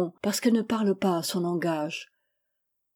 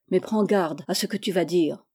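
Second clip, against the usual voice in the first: ordinary delivery, nothing marked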